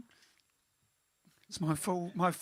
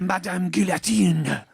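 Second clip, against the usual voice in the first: dramatic pronouncement; 7 dB louder, slower than usual. Pretend. Dramatic slogan ending.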